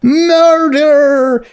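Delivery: high, loud scream; high loud bystander scream; screamed murder. A narrator's reading. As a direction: scream it at the crowd. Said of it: "some pony screamed".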